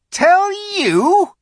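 sudden shrill high witch; shriller. Acted. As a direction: spit it back, suddenly shrill. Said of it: "her voice growing suddenly shriller".

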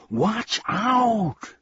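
very high urgent yell; yelled warning. Acted. Milo yelling as the humbug crashes, high alarm. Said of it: "and then he yelled".

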